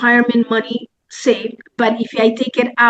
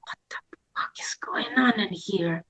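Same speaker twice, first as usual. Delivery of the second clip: soft, voiceless, bewildered aside; stunned whisper to yourself; whispered disbelief; 2 semitones lower, 10 dB quieter than usual. Retold or imitated. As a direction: whisper it as a private thought. Her bewildered inner thought, retold.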